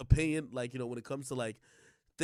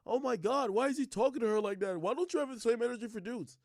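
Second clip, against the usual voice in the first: higher-pitched imitation of shocked critics; mock dismay; 5 semitones higher than usual. Critics fretting over how he talks to her, mock dismay. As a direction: mimic scandalized onlookers.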